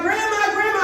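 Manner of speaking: put-on high kid calling out. Granddaughter calling her grandma across the Walmart clothes rack, high eager call.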